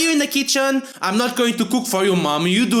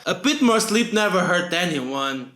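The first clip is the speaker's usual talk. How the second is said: ordinary delivery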